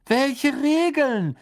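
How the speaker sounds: high, loud, drawn-out question; chorused outcry. A narrator's reading. Four boys to Misses Weasley, chorused outcry. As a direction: cry out in baffled unison. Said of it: "wie aus einem Munde".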